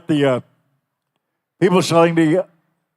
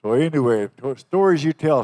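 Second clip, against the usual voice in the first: ordinary delivery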